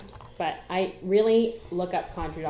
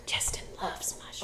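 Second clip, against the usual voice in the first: whispered aside; 8 dB quieter than usual. Real, not acted. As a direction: whisper it behind his back.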